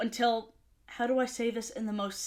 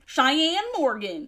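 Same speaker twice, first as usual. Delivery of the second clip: Cheyenne Morgan drawn out slow in a Southern twang; 3 semitones higher, 4 dB louder, slower than usual. Shown as a demonstration. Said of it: "say that with the southern twang".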